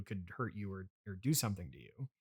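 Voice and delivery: ordinary talk, nothing marked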